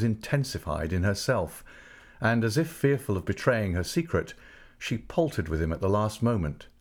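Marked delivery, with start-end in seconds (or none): none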